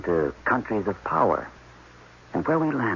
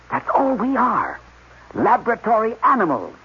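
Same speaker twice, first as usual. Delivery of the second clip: high, loud rising panic; agitated outburst; 8 semitones higher, 4 dB louder than usual. Acted. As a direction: rant it out, near hysteria, slowing on the last words. Panicked scientist ranting about captivity.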